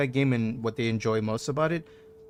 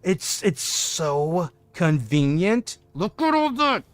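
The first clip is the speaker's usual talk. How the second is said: higher, giddy rush of delight; excited; 6 semitones higher, slower than usual. Real, not acted. Streamer gushing to chat about the crystal haul, giddy. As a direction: gush over the loot. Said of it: "I'm getting aggressive 'cause I'm so excited".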